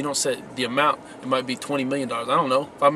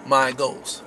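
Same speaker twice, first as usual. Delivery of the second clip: "my" pressed hard and held, "goals" light after it; stress on my. Shown as a demonstration. Vlogger teaching viewers how to title goals, stressing "my". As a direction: lean into "my" so it sounds owned. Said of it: "Emphasis on the word "my".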